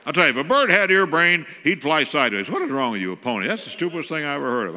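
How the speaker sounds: put-on loud, scornful mom berating the kid; mock the kid's idea with a yell; scolding mom.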